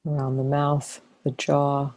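calm, unhurried body-scan voice with long pauses; guided meditation. Real, not acted. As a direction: ease them into relaxing, phrase by phrase. Leads a body-scan relaxation.

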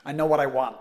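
light New York accent, assertive. Shown as a demonstration. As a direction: say it like a New Yorker who knows. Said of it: "I'm trying to do a New York accent".

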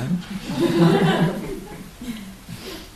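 high-pitched drawn-out laugh; laughter. Real, not acted. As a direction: laugh at your own remark. Laughs at own remark. (rire aigu et prolongé)

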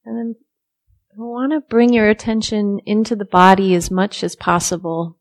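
soft, unhurried meditation guidance with long silences; guided meditation. Real, not acted. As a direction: ease listeners into stillness, softly, leaving room to breathe.

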